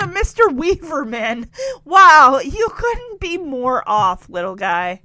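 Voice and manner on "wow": loud amused wow. Real, not acted. Vlogger reacting to the wrong name, loud and amused. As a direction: burst out in disbelief.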